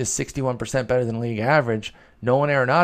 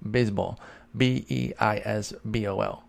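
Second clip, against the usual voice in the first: ordinary talk, nothing marked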